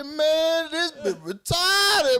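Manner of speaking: high, slow, drawn-out disbelief; hammed-up exasperation; mock exasperation. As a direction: stretch it out in shock. His exasperated reaction.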